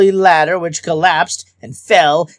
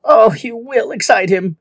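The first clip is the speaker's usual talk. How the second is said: wailed in despair; 8 semitones higher than usual. A narrator's reading. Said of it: "wailed Min in despair".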